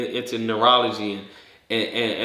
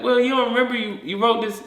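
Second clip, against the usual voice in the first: higher-pitched mock surprise; incredulous; 10 semitones higher, faster than usual. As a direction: remind them, amazed they forgot. Crowd explains to confused person.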